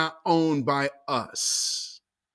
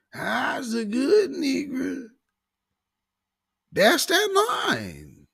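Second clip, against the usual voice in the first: mock subservient voice. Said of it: "We're hearing them same phrasings".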